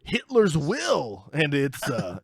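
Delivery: slow, drawn-out appraiser building to the reveal; antiques appraiser. Acted. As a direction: announce the valuation slowly, pitch lifted on the big words. Plays antiques appraiser announcing value.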